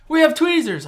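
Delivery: high-pitched mock announcement; excited high imitation of a helper; eager passenger imitated. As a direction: call it out to the whole plane. Passenger calls out the offer.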